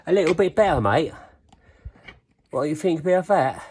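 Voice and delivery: high, loud mock English accent; bad English accent. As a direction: put on a hammed-up English accent. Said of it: "Sorry for the bad English accent".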